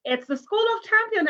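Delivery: a high, bright burst of school pride; proud boast. Real, not acted. Teacher to young listeners, proud excited boast.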